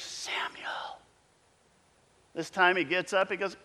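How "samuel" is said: a breathy whisper of his name; whispered call. Acted. God whispering the boy's name in the night. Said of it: "and he hears".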